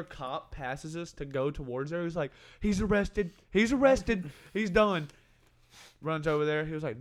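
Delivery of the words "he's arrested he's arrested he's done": high, hammed-up panic; retold freak-out. Nervous friend seeing another cop, imitated panic. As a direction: repeat it in rising mock alarm. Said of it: "starts freaking out bad".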